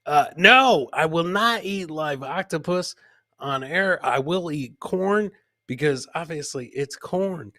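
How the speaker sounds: normal talking voice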